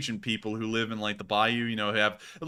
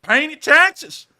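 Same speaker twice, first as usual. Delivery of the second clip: put-on Southern accent building to a rant; 7 dB louder than usual. Ranting about the federal government in a Southern accent. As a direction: get worked up about the IRS, Southern style. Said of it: "legible through that accent".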